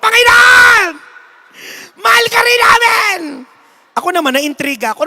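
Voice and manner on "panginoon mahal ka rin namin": very high, very loud crying shout; hammed-up loud, high tearful scream; crowd screaming. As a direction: wail it like a weeping crowd. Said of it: "Sigawan yung mga tao".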